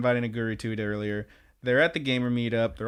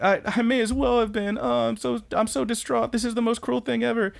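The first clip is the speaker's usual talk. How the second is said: put-on much higher, whiny distraught girlfriend voice; 10 semitones higher than usual. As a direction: play the heartbroken girlfriend up high. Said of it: "she's really distraught on the phone".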